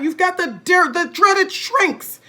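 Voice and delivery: high, slow, loud ghostly dread; spooky taunt. A narrator's reading. Husband spooking wife with the dreaded shrinks. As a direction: make "dreaded" sound scary.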